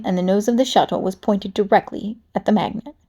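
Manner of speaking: plain reading voice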